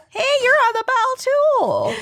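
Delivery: high, squeaky pop singer voice. Pretend. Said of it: "our best [name] impressions".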